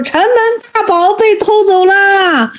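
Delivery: high, drawn-out mock wail; elder's alarm, acted high and slow; alarmed announcement.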